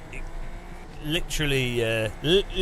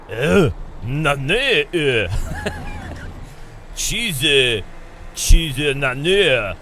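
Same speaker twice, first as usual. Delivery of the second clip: hammed-up Japanese French accent, slow on saucy; mock Japanese French accent; 3 semitones higher, much slower than usual. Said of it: "Japanese French accent".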